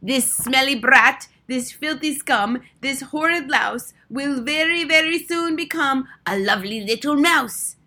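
slow, high, loud gloating insults; venomous drawn-out proclamation; shouting witch. A narrator's reading. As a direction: relish every word as a triumphant witch. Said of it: "and then she shouted out".

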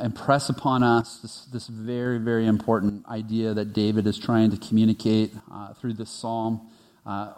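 low, hushed pastoral prayer, slowing and fading toward amen. Real, not acted. Pastor praying over the congregation, a low hushed prayer.

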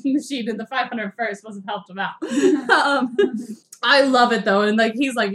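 ordinary delivery

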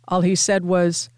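usual speaking voice

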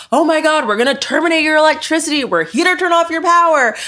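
high, fast, hammed-up alarm voice; mock panicky company rep. Mocking the power company threatening customers, high hammed-up panic. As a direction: parody the utility company's fake emergency. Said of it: "They can tell us".

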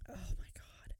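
whispered gossip; a hushed gossipy aside. Real, not acted. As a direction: lean in and whisper it. Gossiping aside about Lacey.

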